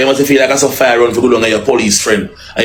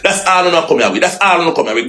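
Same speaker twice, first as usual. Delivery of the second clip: ordinary talk, nothing marked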